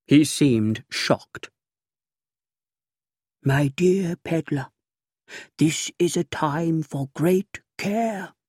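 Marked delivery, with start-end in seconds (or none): 3.4-8.3 softer, higher secretive voice; hushed official. A narrator's reading. Pompous official warns narrator in a hushed voice. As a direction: lean in like a fussy conspirator. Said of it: "his voice a whisper".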